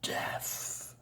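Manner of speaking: barely-there whispered murmur; ghostly murmur. Acted. Voice from nowhere like old leaves, whispered murmur. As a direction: breathe it out like old leaves rustling.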